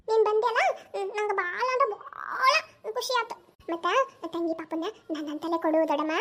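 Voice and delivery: put-on high-pitched, delighted little kid; a small child happily telling aunt, stretching words; excited child. (ಖುಷಿಯಾದ ಪುಟ್ಟ ಮಗುವಿನ ಎತ್ತರದ ದನಿ)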